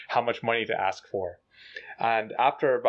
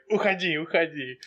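shooing away; 5 semitones higher than usual. Retold or imitated. Officer waves driver away.

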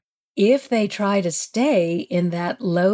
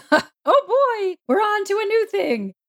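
high, giddy "here we go" voice; hammed-up high-pitched enthusiasm; mock excitement; 12 semitones higher than usual. Acting out her excited dread.

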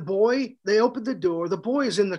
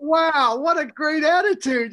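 high, loud delighted wow; amazed admiration; 7 semitones higher, 3 dB louder than usual. Retold or imitated. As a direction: marvel at the kid's attitude. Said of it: "And I thought".

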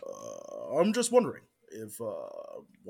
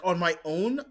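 ordinary talk

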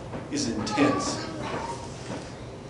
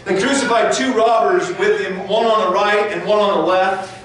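raised, intense preaching read; fervent scripture reading; 6 semitones higher than usual. Acted. Preacher reading the crucifixion to the congregation, raised and fervent. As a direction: build the scene with urgent fire. Preacher reads the mocking scene.

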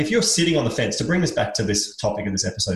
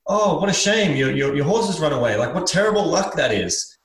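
higher-pitched, commiserating lament; sympathetic neighbour; 5 semitones higher than usual. Acted. Neighbours over the fence pitying the farmer, commiserating. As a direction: tut over someone's bad luck. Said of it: "his neighbors kind of peer over the fence and go".

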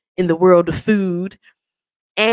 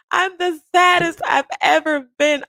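breaking into tears; 13 semitones higher, slower than usual. Retold or imitated. Admitting to Martia she's lying about being fine, crying out. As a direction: voice cracking into a sob. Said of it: "I start to tear up".

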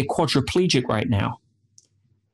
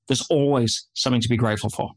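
ordinary talk, nothing marked

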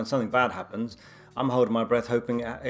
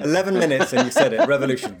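loud teasing through laughter; laughing tease; 4 semitones higher, 8 dB louder than usual. Real, not acted. Friend teases co-host for saying it so soon, laughing. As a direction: tease them while cracking up.

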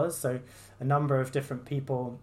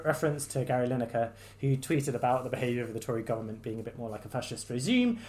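usual speaking voice

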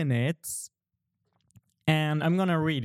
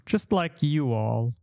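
ordinary talk, nothing marked

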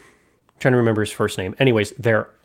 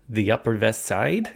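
mock Janosz reply; 4 dB quieter than usual. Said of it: "He pulled it off so bad".

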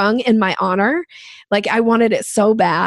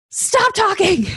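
much higher, sharp mock yell of the boss; retold yell; 12 semitones higher, slower than usual. Boss stops her pitch with a high mock yell. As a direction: bark it like the boss cutting her off. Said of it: "he yells at me".

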